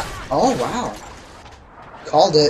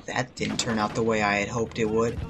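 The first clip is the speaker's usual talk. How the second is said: usual speaking voice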